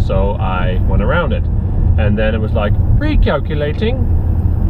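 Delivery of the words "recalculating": slow, much higher robotic sat-nav voice; mock navigation voice, stretched and high; GPS voice. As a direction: drag it out like the sat-nav rerouting. Quoting the GPS voice.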